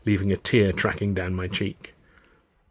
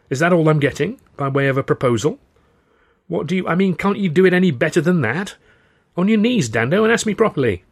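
higher, louder teasing indignation; indignant demand; 9 semitones higher, 6 dB louder than usual. Acted. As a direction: demand a proper proposal, voice lifted. Tilly demands a proper proposal.

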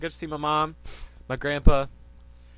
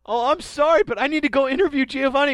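high, loud startled professor; a flustered scientist knocked over, squeaking; Professor Sycamore voice; 7 semitones higher, 6 dB louder than usual. Acted. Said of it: "Hi, I'm Professor Sycamore".

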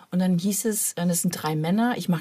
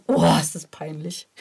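louder mock groan of embarrassment; 6 dB louder than usual. Guest recalling her cringe, a mild mock outburst. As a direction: blurt it out, cringing. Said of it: "sag ganz laut".

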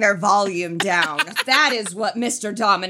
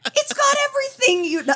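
high excited pitch; faster than usual. Real, not acted. Bragging about Vegas, suddenly pitched high. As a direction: voice jumps up high on got.

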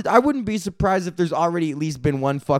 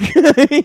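high laugh at his own bit; burst of laughter; 11 semitones higher, 7 dB louder than usual. Real, not acted. Comedian laughing at his own bit, real burst of laughter. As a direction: crack up at the joke.

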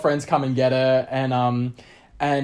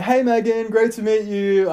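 higher, slowed-down friendly hello; mock cheery greeting; 8 semitones higher, 3 dB louder than usual. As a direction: play up a polite first hello. His cheery greeting message, acted.

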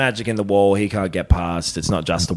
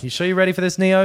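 lightly voiced Trinity, higher; a hint of Trinity, pitched up; higher mock Trinity voice; 8 semitones higher, faster than usual.

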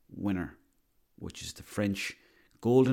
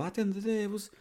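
very high-pitched mock football pundit; grudging verdict in a squeaky put-on voice; high, unimpressed caricature of a pundit; Roy Keane; 11 semitones higher than usual. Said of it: "Roy Keane go".

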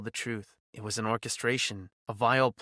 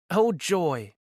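sarcastic; 7 semitones higher, 6 dB louder than usual. Acted. Sarcastic inner thought.

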